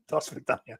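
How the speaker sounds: soft, hushed put-on Russian goodbye; quiet mock Russian accent; Dana impersonation. Dana saying goodbye for the chat, in a hushed Russian accent. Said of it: "my Dana impersonation".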